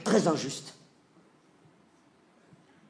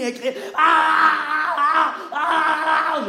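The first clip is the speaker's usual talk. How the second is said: hammed-up labour screams, higher and louder; imitated screams; 9 semitones higher, 6 dB louder, much slower than usual. Retelling the birth, the husband mimics his wife's screams, higher and louder.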